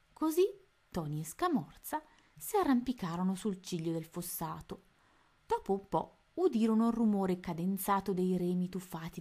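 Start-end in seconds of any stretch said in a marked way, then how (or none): none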